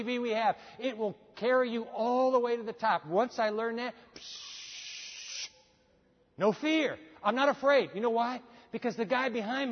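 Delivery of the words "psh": long unvoiced hissing whoosh; imitated whoosh. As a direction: let out a rushing "psh". Imitates zooming up the hill.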